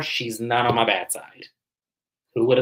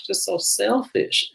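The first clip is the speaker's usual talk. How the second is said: high, drawn-out whine; mock crying; 7 semitones higher, slower than usual. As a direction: imitate a tearful whine, stretching the words. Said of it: "Letitia is crying".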